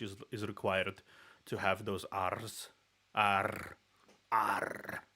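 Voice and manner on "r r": "R" with a trilled rolled R. Shown as a demonstration. Russian speaker showing how Slavs say "R", trilled R. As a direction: roll the R hard on "R". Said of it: "rolling your R's like this".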